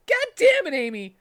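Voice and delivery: high, loud angry yell; mad, serious. A narrator's reading. Tails yelling angrily at Amy, high and loud. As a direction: snap at her furiously. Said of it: "said Tails in a mad, serious voice".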